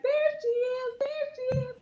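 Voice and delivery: put-on very high, thrilled child voice; little girl calling out. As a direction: squeal it like a kid spotting a star. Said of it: "she was like".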